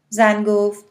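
ordinary delivery, nothing marked

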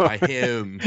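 put-on Miami said as "My him" in a teasing local accent; mock local accent on the city name. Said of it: "where I'm taping this podcast from".

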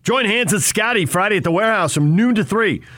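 high, slow promo announcer; hard sell ad. Acted. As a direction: push the deal like a radio ad. Station promo and ad read.

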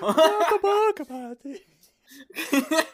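loud, high, drawn-out squeaky echo; mimicked cartoon falsetto. Co-host teasing the other's character voice, hammed-up falsetto. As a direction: parody the silly high voice through laughter.

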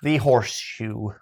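put-on slow Irish accent. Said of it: "That was your Irish accent".

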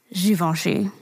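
"Givenchy" said the French way, zhee-von-shee; soft French zh and nasal von in "Givenchy"; "Givenchy" with French sounds, zhee-von-shee. Shown as a demonstration. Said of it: "But it is".

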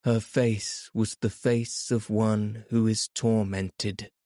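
plain reading voice

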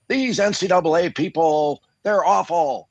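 high-pitched hammed-up rant; mock ranting. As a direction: imitate someone raving in outrage. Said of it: "I'm not out there ranting and raving".

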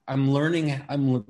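normal talking voice

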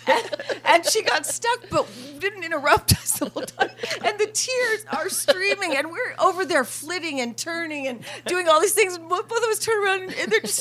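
can barely get it out for laughing; laughing while telling. Real, not acted. Retelling the pool table story, laughing hard.

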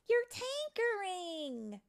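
high, loud, drawn-out announcement; excited revelation. Acted. Rosetta to Tink at the music box, excited reveal. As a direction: stretch it out like a big reveal. Friend reveals Tink's talent.

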